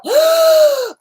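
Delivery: high, loud sharp gasp of panic; startled gasp. Retold or imitated. Remembering waking at three with no mail, loud gasp. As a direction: gasp like you just woke in alarm.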